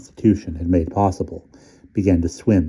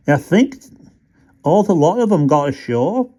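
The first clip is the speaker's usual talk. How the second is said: broad Yorkshire rat, higher; homely country rat replying; 10 semitones higher, 4 dB louder than usual. A narrator's reading. Rat reassuring Stumps, broad Yorkshire. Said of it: "replied the rat".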